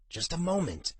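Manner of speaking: high and strained, mid-effort. A narrator's reading. Youth answers the impatient dragon, strained with effort. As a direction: squeeze it out while wrestling a strap. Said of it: "his voice was strained from the effort".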